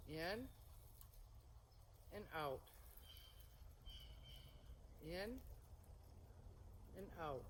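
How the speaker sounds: unhurried cue spaced with long pauses; serene tai chi cues. Shown as a demonstration. Paced breathing cues during form.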